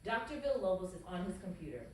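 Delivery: usual speaking voice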